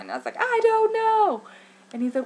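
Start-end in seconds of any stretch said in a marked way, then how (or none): 0.4-1.4 high, loud, drawn-out wail; reenacted high-pitched helplessness; panicked wail. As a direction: stretch it out in helpless exasperation. Retelling her panicked reply to husband.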